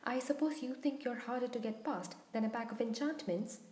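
plain reading voice

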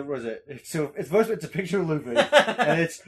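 usual speaking voice

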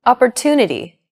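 Opportunity with TU long and stressed. Shown as a demonstration. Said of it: "The stressed syllable to in opportunity".

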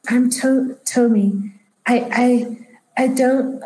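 put-on hesitant, slightly high old woman; elderly woman. Elderly woman introducing herself to strangers, haltingly. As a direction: falter and restart as a confused elder. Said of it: "she's just elderly".

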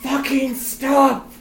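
furious shout. Pretend. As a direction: scream it out in frustration. Acting out furious Dormammu.

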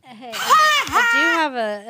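loud, drawn-out call for attention. Real, not acted. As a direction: shout to cut in. Calling out to break in.

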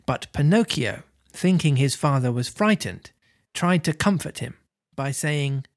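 usual speaking voice

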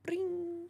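high sung doorbell ring. Acted. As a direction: chime it like a bell. Doorbell sound imitated.

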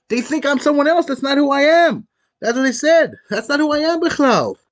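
high, loud, insistent protest; vehement protest. Retold or imitated. Wrongly accused man answering his accusers, acted vehement protest. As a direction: push it up high, protesting the mistake.

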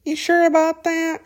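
high-pitched, put-on doubting voice; high mock-skeptical hum and question; nagging inner doubt. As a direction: pipe up like a little voice of self-doubt. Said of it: "Its little ugly head rears up and it's like".

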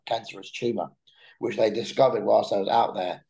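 usual speaking voice

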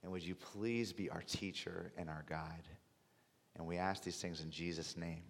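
soft, low prayer voice. Acted. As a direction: pray quietly and reverently. Closing prayer before sermon.

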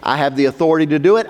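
normal talking voice

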